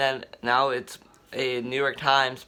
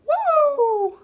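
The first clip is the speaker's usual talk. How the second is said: excited whoop; 5 dB louder than usual. Real, not acted. Celebrating a hero's debut.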